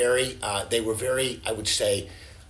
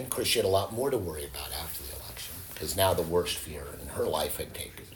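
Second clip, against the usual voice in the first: hushed aside; 5 semitones lower, 6 dB quieter, faster than usual. Real, not acted. Aside about her fear.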